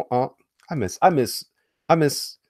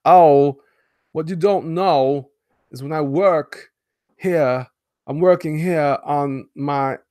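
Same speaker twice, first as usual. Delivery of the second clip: slow, broken-up Walken phrasing, higher and louder; Christopher Walken impression; 6 semitones higher, 7 dB louder, slower than usual. Streamer imagines Walken describing his Bizarro, slow and halting. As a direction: Walken pitching himself as Bizarro. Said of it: "I would love to see Christopher Walken play Bizarro".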